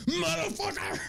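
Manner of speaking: very high, strained mock curse; high-pitched exasperated venting; mock outburst. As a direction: curse them out comically.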